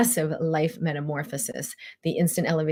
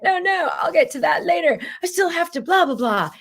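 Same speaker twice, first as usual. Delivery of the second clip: much higher, breezy dismissive voice; brushing it off; 12 semitones higher, 5 dB louder than usual. Retold or imitated. Brushing off life's offer in a high, breezy mock voice. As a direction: wave it away like a busy person. Her dismissive reply to life.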